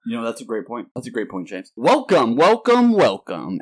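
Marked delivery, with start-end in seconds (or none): 1.8-3.6 loud, swooping host welcome; show-opening welcome. Pretend. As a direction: boom out a big high-pitched podcast greeting. Restarts the show's intro after chaos.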